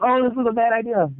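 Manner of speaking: high-pitched put-on regret; mock regretful wail. Retelling a painful knee landing, hammed-up high regret. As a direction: squeak it out like you instantly regret the jump. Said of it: "It's like".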